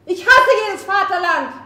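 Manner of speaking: raging outburst; furious outcry. Acted. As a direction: spit out the hatred. Climax of the rebel's outburst.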